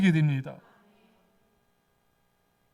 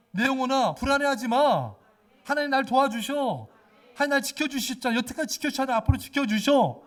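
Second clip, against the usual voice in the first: louder, higher declaration to one's soul; proclaiming; 4 semitones higher, 5 dB louder than usual. Shown as a demonstration. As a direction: proclaim it like David. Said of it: "다윗처럼 외치세요". (다윗처럼 영혼에게 크게 선포)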